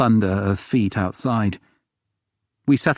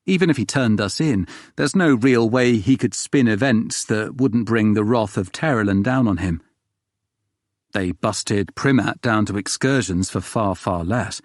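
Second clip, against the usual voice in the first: ordinary delivery, nothing marked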